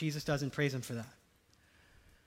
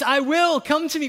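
emphatic call; 9 semitones higher, 10 dB louder than usual. Acted. Quoting Jesus, high loud.

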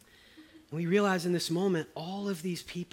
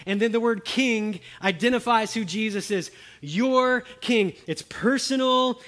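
raised, louder sermon emphasis; fervent preaching; 6 semitones higher, 6 dB louder than usual. Acted. Preacher urging the congregation, fervent raised voice. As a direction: drive it home to the congregation.

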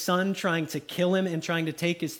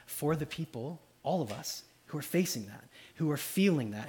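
hushed and lower, drawing listeners in; soft meditative preaching; 5 semitones lower, 7 dB quieter than usual. Real, not acted. Preacher calming his listeners, hushed meditative tone. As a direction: lean in and speak it softly.

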